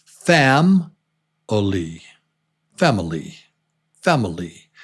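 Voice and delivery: "Family" as FAM-uh-lee, middle barely heard; family with a near-silent middle. Shown as a demonstration. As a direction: say "Family" with a tiny schwa in the middle. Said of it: "but it's pronounced".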